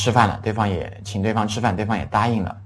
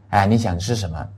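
normal talking voice

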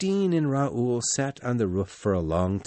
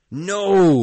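loud, higher, defiant no; firm cry; 9 semitones higher, 9 dB louder than usual. Acted. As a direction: cry out in refusal. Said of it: "Raoul cried".

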